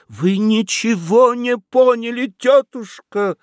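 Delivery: high, slow exasperated exclamation; exclaiming. A narrator's reading. Fedyashev exclaims to his aunt, high and exasperated. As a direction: cry out that she missed the point. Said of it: "воскликнул Федяшев".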